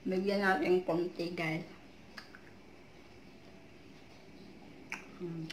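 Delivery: chewing while talking; talking around food; mouth full of mango. Real, not acted. Seen 0: bites mango at 0.8, chews behind hand, then talks chewing.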